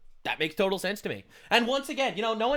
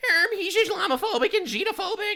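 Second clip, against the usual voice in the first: much higher, spoken through a laugh; 14 semitones higher, 4 dB louder than usual. Real, not acted. Laughing at the ad, much higher, through giggles. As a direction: giggle the words out.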